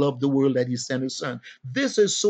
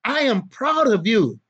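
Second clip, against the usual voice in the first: proud father; 6 semitones higher, 4 dB louder than usual. Acted. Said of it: "They also need to hear another thing".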